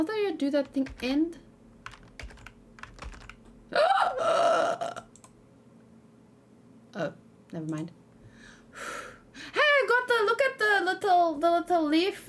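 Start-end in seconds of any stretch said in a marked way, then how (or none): 3.7-5.0 high breathless gasp of surprise; sudden shocked gasp; sharp gasp. Real, not acted. Sudden gasp at game moment. 9.5-12.3 high, louder excited rush; thrilled to show what she caught; bursting with delight. Real, not acted.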